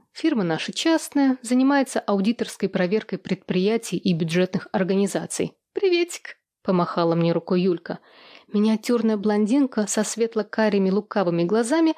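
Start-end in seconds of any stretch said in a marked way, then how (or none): 5.7-6.4 high, chirpy hello; gossipy friend's high-pitched sing-out; bubbly greeting. A narrator's reading. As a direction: chirp it to a colleague.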